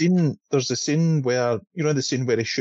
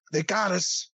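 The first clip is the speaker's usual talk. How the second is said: higher, softer mock American accent; American-sounding Connery delivery; 6 semitones higher, 5 dB quieter, slower than usual. Shown as a demonstration. Showing friends the weird film line, higher mock American accent. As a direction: lift it into an odd American twang, softly. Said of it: "So you kinda gotta go".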